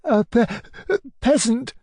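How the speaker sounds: high, stammered tearful answer; cowed stammer. Acted. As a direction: falter on the word, then get it out. Sobbing boy answers his tormentor.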